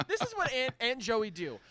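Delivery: ordinary delivery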